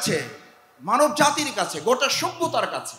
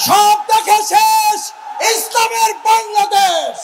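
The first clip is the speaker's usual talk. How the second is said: high, drawn-out shouted slogan; slow, loud rallying cry; slogan shouting; 13 semitones higher, 9 dB louder, slower than usual. Real, not acted. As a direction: lead the crowd's chant at full voice. Leads crowd in a slogan.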